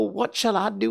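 high, quick frantic question; tearful plea. Acted. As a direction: ask it in a panic. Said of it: "burst out crying".

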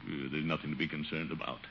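low, soft talking to himself; uneasy self-reassurance. Acted. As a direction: try to calm yourself under your voice, low. Alone at dark, reassuring himself.

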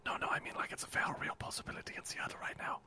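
hushed urgent warning; whispering. Acted. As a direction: whisper it insistently. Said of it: "why are you whispering".

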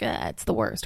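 imitating Ben, low and grumbling; mock groan. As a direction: groan it like a mopey boyfriend. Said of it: "Ben is gonna be like".

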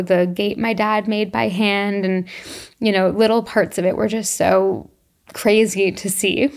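ordinary talk

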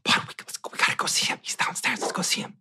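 pleading unvoiced whisper; coaxing whisper. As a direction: coax the scared boy in a hush. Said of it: "my mom's like".

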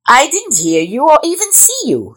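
high-pitched creature voice, defending itself; protesting iguana. A narrator's reading. Iguana answers the snake's charge, protesting high. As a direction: plead innocence in a high voice.

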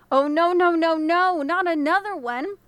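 no, no, rising panic; panicked dismay. Acted. The frightened traveller finding yet another body, wailing. Man sees a third body.

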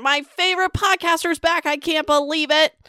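high-pitched gushing listener; mock excited fan. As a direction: play a thrilled fan spreading the word. Voices a fan telling everyone.

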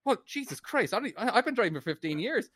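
high-pitched, flustered exasperation; going nuts. Acted. Driver retelling his fluster to the cop, exasperated at himself. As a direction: rant in agitated disbelief, pitched well up. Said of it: "I'm just going nuts".

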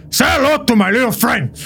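high, gangster movie growl, pushed up; a hammed-up Scarface gangster line; Tony Montana impression. Paramedic mocking a fire-spraying man as Scarface. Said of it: "in the best Tony Montana impression".

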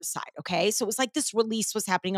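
ordinary delivery

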